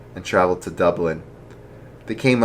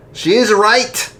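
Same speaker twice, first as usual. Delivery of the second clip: father voice; 14 semitones higher, 6 dB louder than usual. Acted. Father agrees.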